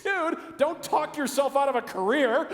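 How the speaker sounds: mock scolding. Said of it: "I go".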